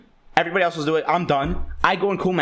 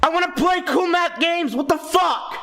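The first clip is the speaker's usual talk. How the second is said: put-on pissed-off kid; 7 semitones higher than usual. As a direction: shout it like a furious ten-year-old. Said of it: "And I was pissed".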